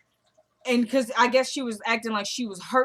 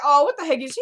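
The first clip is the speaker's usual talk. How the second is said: louder, higher retort in a retold quarrel; raised-voice imitation of someone bickering; mock indignation; 6 semitones higher, 7 dB louder, faster than usual. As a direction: act out an affronted comeback. Acted quarrel in retold story.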